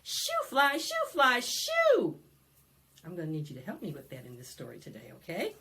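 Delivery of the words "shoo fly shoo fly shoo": higher, louder chant; call-along shout. Shown as a demonstration. Librarian leading kids in the shoo chant, loud. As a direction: belt it so the kids join. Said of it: "Try it".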